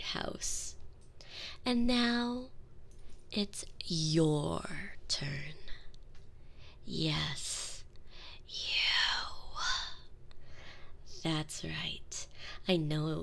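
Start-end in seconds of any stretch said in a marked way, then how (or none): 1.6-12.3 very slow, breathy threat; menacing whisper. Acted. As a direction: whisper slowly to the next victim. Turning on the next maid.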